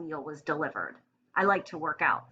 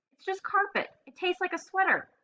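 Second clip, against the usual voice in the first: disgusted hamster; 11 semitones higher than usual. A narrator's reading. Hamster complaining after escape.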